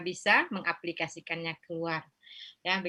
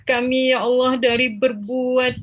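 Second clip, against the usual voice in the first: pleading prayer building from earnest to higher and louder; fervent prayer; 6 semitones higher, 12 dB louder than usual. Acted. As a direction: rise into an impassioned plea for forgiveness. Prayer rising to its climax.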